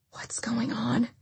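high, near-whispered question; said quietly. A narrator's reading. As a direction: ask softly and fearfully. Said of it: "Carrie said quietly".

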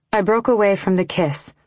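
usual speaking voice

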